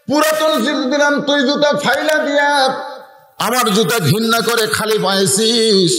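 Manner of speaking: high-pitched acted reproach; aggrieved teacher's high preaching cry; scolding teacher. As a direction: scold the pupil with a high, ringing complaint. Teacher scolding pupil in story.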